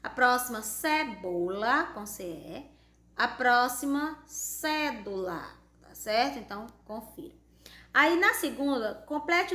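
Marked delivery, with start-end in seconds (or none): 0.6-1.8 slow dictation. Shown as a demonstration. Teacher dictating words for spelling. 4.3-5.5 slow dictation. Shown as a demonstration. Teacher dictating words for spelling.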